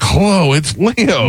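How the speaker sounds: put-on high, thin old man on the phone. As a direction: imitate an elderly uncle calling. Imitating uncle on soundboard prank.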